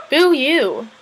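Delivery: high, loud mock boo; mock jeer. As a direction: jeer it out in playful imitation. Retold jeer at sarcastic remark.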